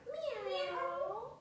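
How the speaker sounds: a high, rising playful meow; cat meow. Pretend. Teacher meowing for the cat pose, high and rising.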